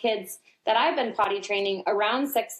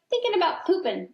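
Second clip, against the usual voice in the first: put-on much higher, matter-of-fact little boy; toddler's voice; 10 semitones higher than usual. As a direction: pitch up into a carefree toddler. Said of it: "And he's like".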